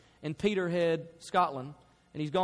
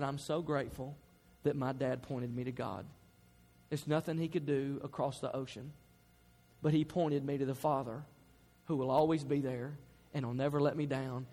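grateful son; 5 semitones lower, 5 dB quieter than usual. Retold or imitated. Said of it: "You know what Philip Cameron says".

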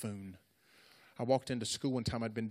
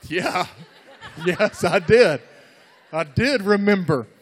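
loud, high, overplayed confirmation; mock-proud shouted reply; bluffed assurance; 7 semitones higher, 18 dB louder, slower than usual. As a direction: insist too hard, hammed up. Bluffing to delighted girlfriend.

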